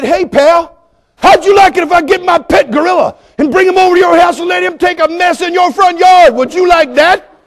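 high, loud retold outburst; angry challenge. Pastor threatening dog owner with a pet gorilla, high angry shouting. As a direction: lose your temper and yell the question.

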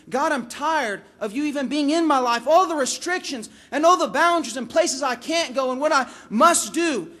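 building, loud protest to God; acted exasperation, climbing in volume; fed-up complaint. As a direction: let the frustration pile up and rise. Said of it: "we as Christians get tired".

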